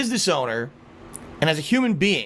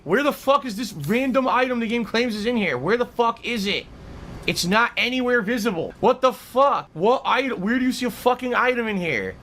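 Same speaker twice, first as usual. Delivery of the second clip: higher-pitched exasperated griping; real anger; 4 semitones higher than usual. Real, not acted. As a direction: demand answers in mounting frustration. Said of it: "I'm getting angry".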